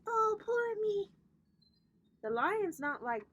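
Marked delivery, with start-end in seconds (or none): 0.1-1.1 high, drawn-out poor-me whine; mock self-pity. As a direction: put on exaggerated self-pity, high and slow. Mocking self-pity in quote.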